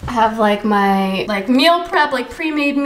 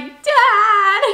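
put-on whiny kid; 9 semitones higher, 4 dB louder than usual. Kid protesting dad joke.

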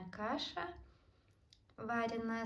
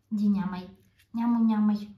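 loud sing-song coaxing; loud playful urging; 10 dB louder than usual. Real, not acted. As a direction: call it out playfully. Urging pet to eat.

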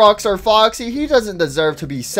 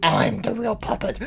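hushed, low, whispery claim; soft eerie puppet voice; quiet puppet; 4 semitones lower, 10 dB quieter than usual. Acted. Rival puppets arguing over identity.

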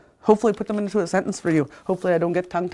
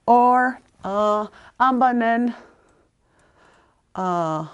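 ordinary talk

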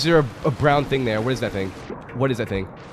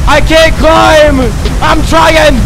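high, loud, whiny frustration; frustrated yelling; 10 semitones higher, 10 dB louder than usual. Real, not acted. Gamer stuck on a ledge yells at the game, high whiny frustration. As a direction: yell it like you just can't climb.

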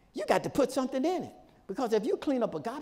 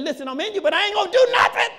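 building to a high, loud protest; emphatic mock refusal; 11 semitones higher, 7 dB louder, faster than usual. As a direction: act out a refusal, climbing to a shout. Voices Holy Spirit's refusal, building.

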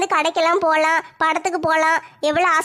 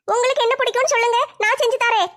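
put-on higher, childlike younger sister complaining; whiny kid sister, voice raised high; 6 semitones higher than usual. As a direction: pitch up as the weary little sister.

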